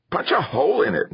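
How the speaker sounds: high, urgent narrated shout. A narrator's reading. Nico urging Kirk to break the air tank, shouted. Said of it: "Nico shouted".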